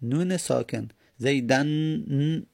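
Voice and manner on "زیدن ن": "زیدن" slowly, its final n held; silent-vowel nun. Shown as a demonstration. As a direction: draw out "زیدن", then hum "ن" alone. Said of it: "نون ساکنه".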